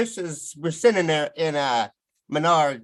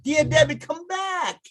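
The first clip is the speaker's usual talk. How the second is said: high; 14 semitones higher, slower than usual. Retold or imitated. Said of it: "I'm like".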